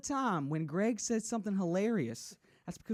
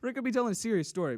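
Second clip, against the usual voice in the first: ordinary talk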